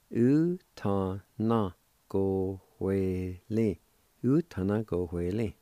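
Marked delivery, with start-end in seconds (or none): 0.1-3.8 slow, each syllable of "u ta na go hue li" drawn out; slow demo. Shown as a demonstration. Teacher putting the Cherokee word for ledger together slowly. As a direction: join the parts slowly for the learners. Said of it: "And together".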